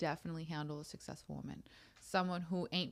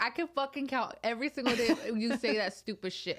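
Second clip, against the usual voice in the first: husband laughing mid-quote; 5 semitones higher, 4 dB louder than usual. Retold or imitated. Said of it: "he's like".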